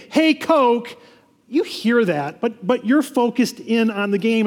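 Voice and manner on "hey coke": vendor calling out. Retold or imitated. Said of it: "as they say".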